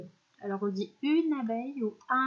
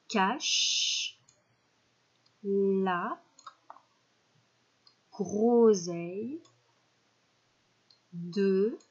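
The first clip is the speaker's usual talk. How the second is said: slow checking; much slower than usual. Shown as a demonstration. Teacher checking the sentence word by word, slow. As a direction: stretch each word slowly with pauses. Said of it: "on vérifie ensemble".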